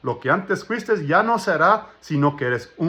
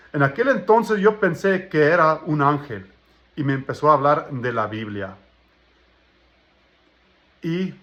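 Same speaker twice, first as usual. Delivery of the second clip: ordinary delivery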